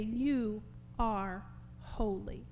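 "You are holy" drawn out slowly; measured, reverent "You are holy"; slow reverence. Acted. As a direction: let each word of "You are holy" stand apart, unforced. Repeats the verse with differing force.

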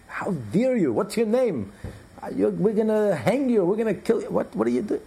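high-pitched mock tirade; acted yelling. Military chief threatening the sailor who won't move, mock yelling. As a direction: sputter threats in hammed-up outrage. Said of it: "he starts yelling".

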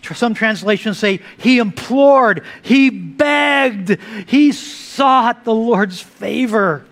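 usual speaking voice

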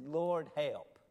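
loud, imitated plea; retold cry for help. Preacher retelling Peter's plea, mildly urgent cry. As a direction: call out Peter's plea with force.

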